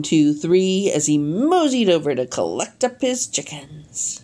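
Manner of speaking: ordinary delivery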